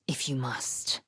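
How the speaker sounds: soft whispered menace; hushed dark aside; whispered threat. Acted. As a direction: let it fall to a whisper.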